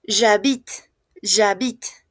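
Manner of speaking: J'habite with the final e left silent. Shown as a demonstration. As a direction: end J'habite crisply on the t sound, no vowel after. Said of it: "No se pronuncia".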